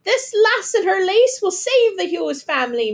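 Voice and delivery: high, bright-pitched proud call. A narrator's reading. Neighbour praising Fiona at the party, high call. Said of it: "Missus O'Flaherty called out".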